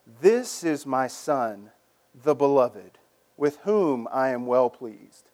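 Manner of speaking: a lightly voiced God, slow and grave. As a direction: intone it slowly like God speaking. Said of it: "a voice from heaven said".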